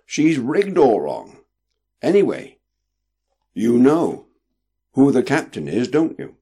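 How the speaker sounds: insist, weighing every word; definite. A narrator's reading. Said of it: "with a most definite ring to his voice".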